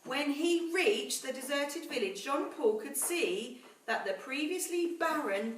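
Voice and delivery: put-on slow, measured storytelling to children; story narration. A narrator's reading. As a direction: read it like a bedtime story to the class. Teacher reads story to class.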